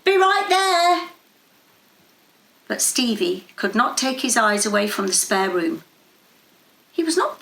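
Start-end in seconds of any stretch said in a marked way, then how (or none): 0.0-1.1 high, slow call back down the stairs; boy answering his father from upstairs. A narrator's reading. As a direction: answer loud enough to carry downstairs.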